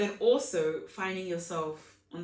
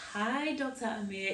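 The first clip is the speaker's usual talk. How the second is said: ordinary delivery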